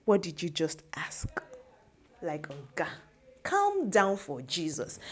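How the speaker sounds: hushed, slow challenge; imitated quiet confrontation.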